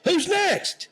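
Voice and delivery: high impatient yell, imitating; mock yell. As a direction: snap it out at a silent crowd. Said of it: "he yells at him".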